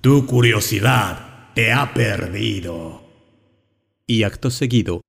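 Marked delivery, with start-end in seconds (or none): 0.0-3.1 lenta, grave y cavernosa; voz de ultratumba. A narrator's reading. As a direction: sentencia desde la tumba. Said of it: "con voz de ultratumba le gritó". (voz lenta de ultratumba)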